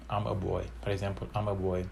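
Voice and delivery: usual speaking voice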